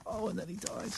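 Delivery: soft, choked-up murmur; quiet, heavy-hearted aside; quietly emotional. Real, not acted. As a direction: let the sadness pull the voice right down. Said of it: "I'm getting all emotional".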